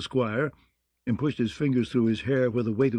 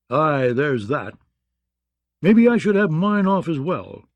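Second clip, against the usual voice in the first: bluff, booming soldier; hearty knight voice; 4 semitones higher, 5 dB louder than usual. A narrator's reading. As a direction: play a gruff hearty knight. Daven jesting.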